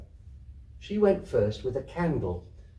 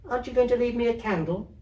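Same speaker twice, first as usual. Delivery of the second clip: put-on very high, quick little girl; pleading child; 11 semitones higher, faster than usual. Kezia begging her grandmother for a candle, high and quick. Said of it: "Kezia to grandmother".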